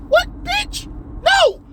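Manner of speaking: very high incredulous squawk; outraged screech. Acted. Rejecting Ellie's claim to be a dad, shrieked disbelief. Outraged reply to quoted line.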